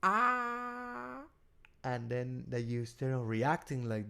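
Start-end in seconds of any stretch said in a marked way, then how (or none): 0.0-1.2 high sustained "aah"; long high-pitched mock cry; reactive outburst. Shown as a demonstration. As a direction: imitate someone about to explode. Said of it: "So this guy".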